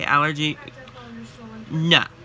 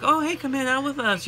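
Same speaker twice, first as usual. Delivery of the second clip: cheery friends; 11 semitones higher than usual. Retold or imitated. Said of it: "friends or family that are like".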